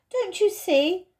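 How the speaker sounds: lightly voiced girl, insistent; earnest young girl. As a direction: press the others to help. Lucy pleads to save fawn.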